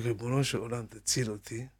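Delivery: usual speaking voice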